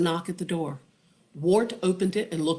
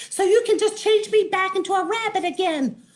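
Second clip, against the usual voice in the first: fast, very high, demanding; squeaky insistent plea; Wanda; 14 semitones higher, 5 dB louder, faster than usual. Acted. Wanda demands the Wizard reverse it, fast. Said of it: "Wanda said".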